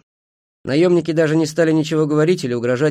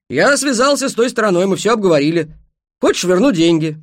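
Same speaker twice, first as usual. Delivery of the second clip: higher, louder station master's voice; loud, high-pitched offer in character; начальник станции; 8 semitones higher, 8 dB louder than usual. Acted. (начальник станции, громко и высоко)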